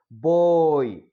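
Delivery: put-on "boy" very slow, with a long oi glide; slow model pronunciation. Shown as a demonstration. Teacher showing a diphthong, "boy" slowly drawn out. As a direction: stretch the oi in "boy". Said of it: "slow like that".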